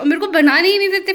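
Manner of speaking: fast, loud pretend outrage; mock complaint. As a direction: whine it up like a wronged kid. Complaining she isn't allowed cook.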